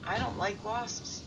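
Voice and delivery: very quiet, dead flat; deadpan. Retold or imitated. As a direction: say it straight to camera, no emotion. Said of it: "dead looks at the camera and says".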